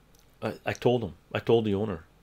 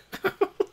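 high laugh. Real, not acted. Laughing at his own story.

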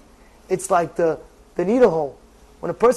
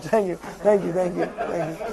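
mumbled thanks; 7 semitones lower, 10 dB quieter than usual. Acted. Fading mock thanks.

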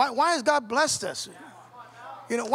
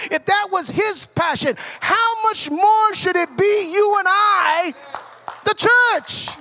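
full-force preaching; 5 dB louder than usual. Real, not acted. Preacher challenging the church to be like Christ, building fervently. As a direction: hammer each phrase, then cry out to the congregation.

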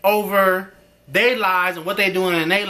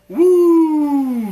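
excited woo; 8 semitones higher, 7 dB louder than usual. Real, not acted. Reacting to her life falling apart.

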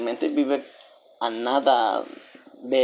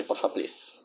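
susurro; 10 semitones lower, 9 dB quieter, slower than usual. Pretend. Pide a la Sim que se bañe.

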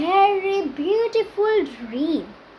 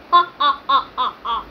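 mocking lion laugh; 3 semitones lower, 3 dB louder, much faster than usual. Lion mocks rat's offer.

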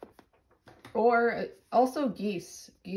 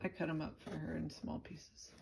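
quiet; 4 semitones lower, 12 dB quieter, faster than usual. Real, not acted. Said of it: "repeated quieter".

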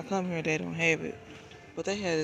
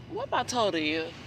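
ordinary delivery

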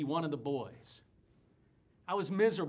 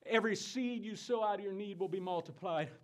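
ordinary talk

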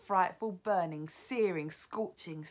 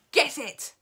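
loud, higher barked command; witch's shout; 9 semitones higher, 12 dB louder than usual. Acted. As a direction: shout at the witches to grab him. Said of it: "shouted the Grand High Witch".

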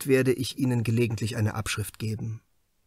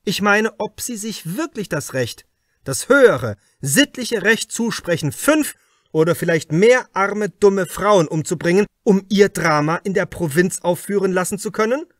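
a raised, higher, pressing voice, louder; probing inspector; 7 semitones higher, 7 dB louder than usual. Acted. Inspector Krause questions the suspect writer Hartwig, louder and pressing. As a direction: lean in hard, like an interrogator closing in.

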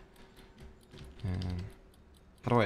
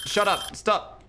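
high-pitched exasperated outburst; 9 semitones higher, slower than usual. Real, not acted. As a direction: tell the noise off, voice jumping up. Snaps at nagging game noise.

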